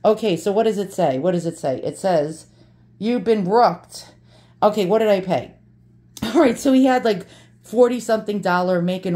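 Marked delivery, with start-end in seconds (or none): 3.0-4.1 slow mock-solemn reading. Reading the fake inscription to viewers, slow and solemn. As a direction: read it out slowly like a verdict.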